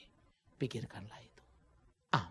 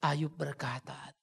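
normal talking voice

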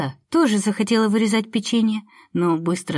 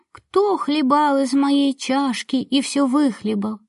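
high, slow squeaky little voice; squeaky bear cub; 6 semitones higher, slower than usual. Acted. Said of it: "запищал тонким голосом".

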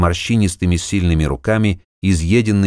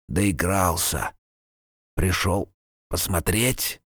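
faint, slow, rasping; pained dying rasp; 8 dB quieter, slower than usual. A narrator's reading. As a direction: force out each word, dying. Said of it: "Слова текли мучительно и медленно".

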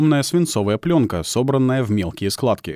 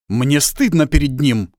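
slow, loud, higher-pitched rebuke; father getting more wound up; worked-up anger; 5 semitones higher, 5 dB louder, slower than usual. Acted. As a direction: shame the boy loudly, drawing it out. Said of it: "взвинчивается всё сильнее".

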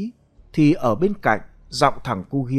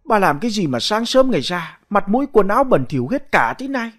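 put-on an old man's raised, higher scold; scolding husband; 6 semitones higher, faster than usual. Old husband scolding his wife about her dirt, raised and higher. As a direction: chide the wife for her filthy clothes.

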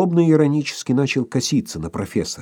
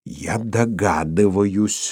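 spiteful; 2 semitones lower, much slower than usual. A narrator's reading. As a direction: bite each word out. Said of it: "злобно краснея воскликнул".

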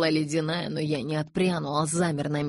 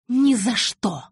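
higher, louder furious refusal; angry hiss; 7 semitones higher, 6 dB louder, slower than usual. A narrator's reading. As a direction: cut in sharply, hissing with anger. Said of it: "прошипела Розали". (злобно, резко, с шипением)

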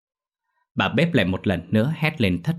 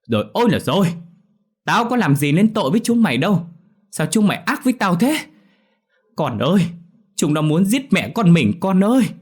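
much higher, loud, theatrical lament; fake wailing; 10 semitones higher, 5 dB louder than usual. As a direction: wail with crocodile tears. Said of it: "vừa nói vừa khóc lóc".

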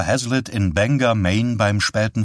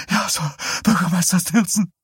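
put-on scared boy; 10 semitones higher than usual. A narrator's reading. Boy repeats answer, higher.

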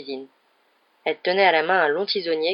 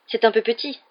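quick, higher-pitched apology; hurried; 5 semitones higher, 3 dB louder than usual. A narrator's reading. Boy apologising for his bedroom, hurried. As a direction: get it out hastily. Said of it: "dit précipitamment Ron".

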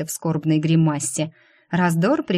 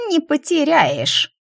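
high, slow, drawn-out whine; thin drawl; 9 semitones higher, 4 dB louder, slower than usual. A narrator's reading. Gaunt demon contradicts the prisoner, thin drawl. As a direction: stretch it out thinly, way up high. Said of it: "тонко протянул голод".

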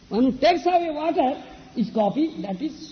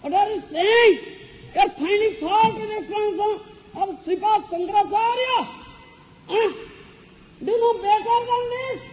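scolding the pupil, pitched well higher; a hammed-up teacher's outburst at his student; angry rebuke; 8 semitones higher than usual. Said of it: "Then he got angry".

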